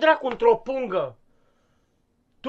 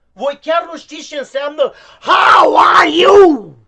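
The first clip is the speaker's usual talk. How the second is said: furious shout; 6 semitones higher, 12 dB louder than usual. Acted. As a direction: yell it in exasperation, full force. Teacher yelling at students.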